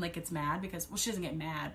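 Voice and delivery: ordinary talk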